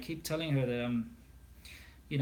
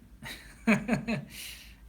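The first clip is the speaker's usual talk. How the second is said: laugh; 8 semitones higher than usual. Real, not acted. Laughing at own accent joke.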